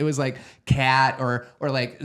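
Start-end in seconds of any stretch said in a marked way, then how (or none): 0.6-1.2 louder demo of a Wisconsin accent. Shown as a demonstration. Showing how they said cat, Wisconsin accent. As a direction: give "cat" a Wisconsin vowel. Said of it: "a pretty Wisconsin accent".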